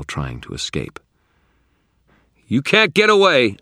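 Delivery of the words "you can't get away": high, loud challenge; shouting. A narrator's reading. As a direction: shout down a struggling monster. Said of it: "I shouted at it".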